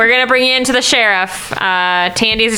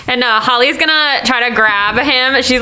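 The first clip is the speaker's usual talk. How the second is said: ordinary delivery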